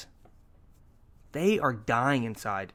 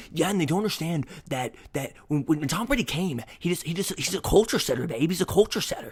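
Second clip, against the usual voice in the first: higher-pitched, insistent fan rant; reenacted rant; 5 semitones higher than usual. Sports fan ranting to his girlfriend, worked-up retold rant. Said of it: "rant to my girlfriend".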